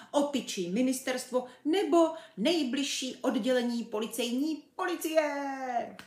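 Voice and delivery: high, urgent pretend newsreader; hammed-up public warning; mock TV bulletin. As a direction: rattle out a police appeal with mock importance. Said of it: "mimořádné televizní hlášení".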